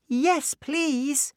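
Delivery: put-on high, bright child voice; little girl voice. A narrator's reading. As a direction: speak as young Clara. Clara speaks.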